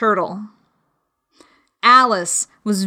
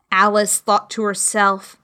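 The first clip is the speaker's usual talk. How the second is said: plain reading voice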